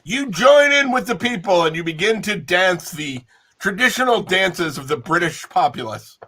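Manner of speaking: ordinary delivery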